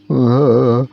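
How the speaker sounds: loud scared cry; frightened shriek. A narrator's reading. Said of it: "continued Bobby Bus".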